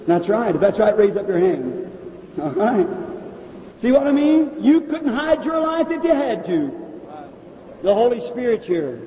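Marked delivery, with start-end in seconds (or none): 3.8-6.7 pitched up high in wonder; thrilled preacher's high exclamation; excited marvel. Real, not acted. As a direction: lift it high, amazed at the revelation. Preacher marvels at revealed secrets.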